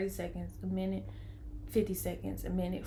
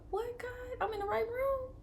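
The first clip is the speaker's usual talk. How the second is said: much higher, choked with tears; tearful awe; 5 dB quieter than usual. Real, not acted. Mother overcome in the delivery room, tearful and high. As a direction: near crying, asking God. Overwhelmed by the scripture sign.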